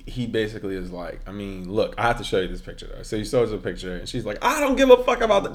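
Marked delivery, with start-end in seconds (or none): 4.4-5.5 high, fast, loud outburst; imitated angry yell. Woman cutting off his picture-showing, yelling impatiently. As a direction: mimic her yelling him down. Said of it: "So she yells".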